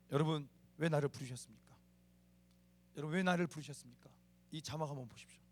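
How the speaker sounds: ordinary talk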